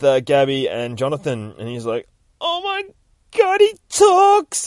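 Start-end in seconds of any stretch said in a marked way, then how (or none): 2.4-4.7 very high, drawn-out, loud squeal of wonder; high falsetto astonishment; amazed squeal. Acted. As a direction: squeal in gleeful disbelief. Deadpool amazed by talking wolverine.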